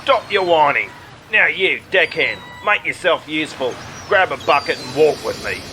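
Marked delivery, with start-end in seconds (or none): none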